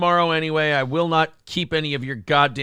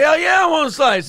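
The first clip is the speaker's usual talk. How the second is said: eager yell; 10 semitones higher, 5 dB louder than usual. Pretend. Eager quote in the bit.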